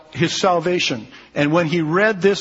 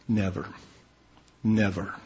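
"Never" said low and soft, then again, each with a firm falling close; emphatic never; 6 semitones lower, 6 dB quieter than usual. Shown as a demonstration. Preacher driving home to the church that Jesus will never cast them out, low and firm. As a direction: drop the voice and lean on "Never" twice. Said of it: "the emphasis on this word "never" is extremely strong".

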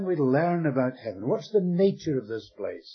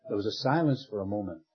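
normal talking voice

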